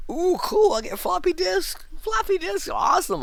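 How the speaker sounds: squealing high-pitched delight; excited. Pretend. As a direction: gush like a thrilled kid. Mock childlike thrill, very high.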